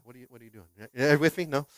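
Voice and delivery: normal talking voice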